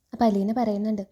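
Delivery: ordinary delivery, nothing marked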